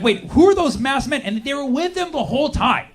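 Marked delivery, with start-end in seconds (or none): 0.0-1.2 surprised. Retold or imitated. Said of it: "you're like".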